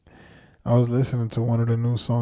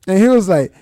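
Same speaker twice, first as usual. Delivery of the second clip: normal talking voice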